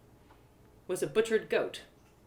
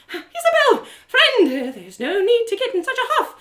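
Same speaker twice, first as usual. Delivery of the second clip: high, louder attempt to soothe; insistent, pitched-up reassurance; urgent calming; 13 semitones higher, 9 dB louder than usual. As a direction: talk a friend down firmly. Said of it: "she grabbed my shoulders to calm me down".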